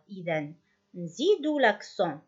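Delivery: normal talking voice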